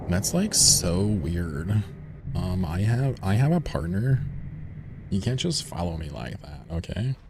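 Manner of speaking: drawn-out Valley Girl accent, acting creeped out; ditzy Valley Girl whining at a follower. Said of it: "talking like a shitty Valley Girl accent".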